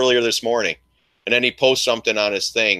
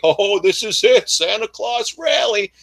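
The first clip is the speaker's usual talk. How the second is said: mock hopeful buyers; 9 semitones higher than usual.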